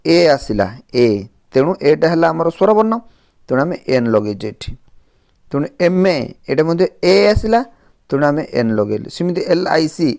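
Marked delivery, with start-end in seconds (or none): none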